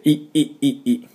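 "い" in short, high, tense bursts; repeated squeezed "い", clenched and high; strong strained i. Shown as a demonstration. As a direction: push out each "い" from the belly. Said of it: "お腹に力を入れて、".

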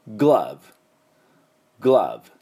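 グラブ with a short a sound; English glove. Shown as a demonstration. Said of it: "英語でなんて発音するかというと".

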